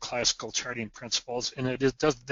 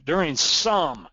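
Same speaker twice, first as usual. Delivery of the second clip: "some" punched louder and higher; stress on "some"; 4 semitones higher, 5 dB louder, slower than usual. Real, not acted. Lecturer flagging a qualifier for chart students, stress on "some". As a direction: lean hard on "some" with a swooping emphasis. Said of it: "I wanna emphasize the word some".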